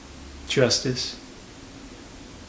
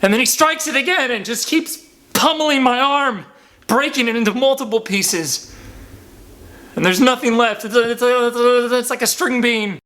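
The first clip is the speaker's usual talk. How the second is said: agitated; 12 semitones higher, 3 dB louder than usual. Real, not acted. Reliving the beating, voice climbing.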